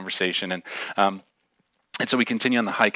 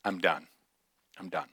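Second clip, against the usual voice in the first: low, much softer, final; resigned giving up; 4 semitones lower, 11 dB quieter than usual. Retold or imitated. Girl refusing the leader at the false summit, resigned. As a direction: drop the voice right down, quitting.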